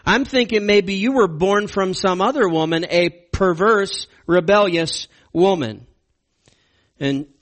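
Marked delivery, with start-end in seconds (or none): 0.0-5.9 higher, louder scornful king; mock-angry father berating his son; voicing Saul's contempt. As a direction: sneer it at Jonathan like an angry father. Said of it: "He's basically saying".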